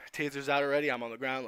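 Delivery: ordinary delivery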